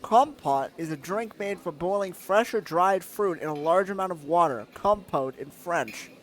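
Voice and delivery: normal talking voice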